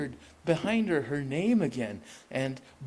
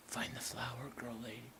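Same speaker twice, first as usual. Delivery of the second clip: whisper; 11 dB quieter than usual. Acted. Dying man's urgent plea.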